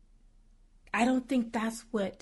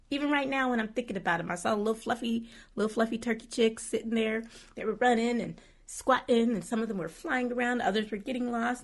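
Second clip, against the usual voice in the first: normal talking voice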